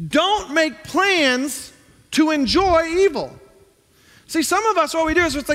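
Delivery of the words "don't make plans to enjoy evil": each word slowed and higher; weighty verse read very slowly; slow emphatic reading. Shown as a demonstration. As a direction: lean hard on every word. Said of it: "it continues".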